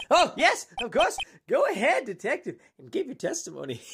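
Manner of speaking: put-on stern prosecutor with a German accent; haughty German-accented prosecutor. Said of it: "a German accent instead of Russian now".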